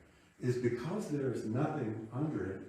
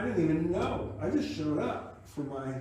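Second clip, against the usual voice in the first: usual speaking voice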